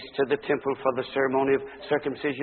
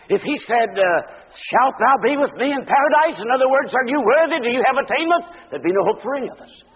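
high, loud, ringing pulpit voice; full-force preaching; 11 semitones higher, 9 dB louder than usual. Acted. Preacher thundering the grammar of the promise, loud. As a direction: preach it out to the back pews.

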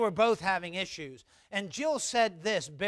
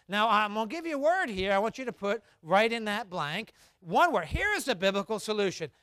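ordinary delivery

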